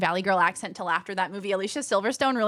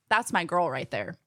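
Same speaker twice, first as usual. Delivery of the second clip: ordinary talk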